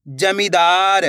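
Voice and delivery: "जमींदार" drawn out, last "दार" held long; slow model reading of "जमींदार"; blended word. Shown as a demonstration. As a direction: stretch "जमींदार" as one joined word. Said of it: "तो मिलके बन जाएगा".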